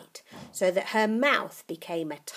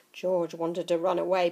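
usual speaking voice

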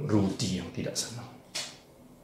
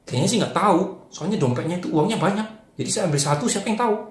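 put-on higher, louder boy explaining; higher child voice; 6 semitones higher, 3 dB louder than usual. Rudi telling Anton about the money, higher child voice. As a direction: voice the boy a bit high.

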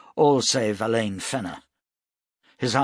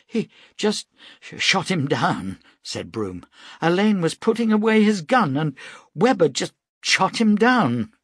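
distraught outburst; 9 semitones higher, 5 dB louder than usual. A narrator's reading. Broom distraught over killing.

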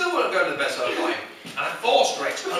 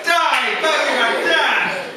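loud, high, drawn-out attacking shout; mock battle cry; 7 semitones higher, 6 dB louder, much slower than usual. Boy attacks bogeyman with a stick, mock-furious shout. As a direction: yell it like a child charging a monster.

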